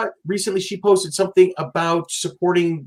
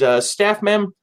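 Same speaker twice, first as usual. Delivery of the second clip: normal talking voice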